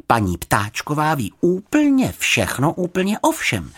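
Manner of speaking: put-on high, slow, emphatic child character; girl confiding a known fact; explaining girl. A narrator's reading. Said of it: "vysvětlila mu Juditka".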